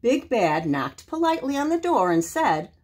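ordinary delivery

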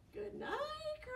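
high good night; sing-song good night. Shown as a demonstration. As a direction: call good night brightly. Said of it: "by saying what".

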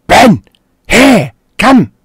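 high, very loud one-word commands; yelling. Retold or imitated. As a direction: yell sharp orders at a dog. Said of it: "and I yell at Ben".

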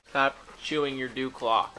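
normal talking voice

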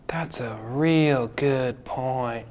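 slow hushed whisper; whispered agreement to his friend. Acted. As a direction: breathe it out, humbled and slow. Agreeing, humbled.

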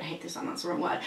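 whispered self-correction; muttered aside. Real, not acted. Catching her own wrong word, muttered aside. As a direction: say it under your breath. Correcting herself under breath.

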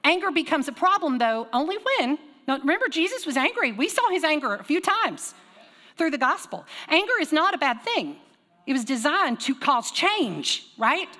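fervent preacher's delivery; impassioned sermon voice; preaching. Acted. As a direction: preach it with rising conviction.

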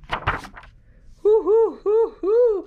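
loud high owl hoo; owl hoot. Acted. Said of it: "called Owl".

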